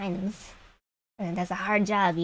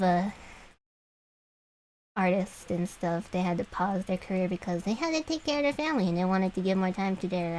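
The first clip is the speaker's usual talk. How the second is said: usual speaking voice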